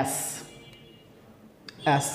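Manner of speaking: American-accented letter "S". Shown as a demonstration. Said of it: "in American accent". (American উচ্চারণে "S")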